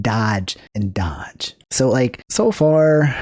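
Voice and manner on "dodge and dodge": whisper. Real, not acted. Mocking Linus dodging.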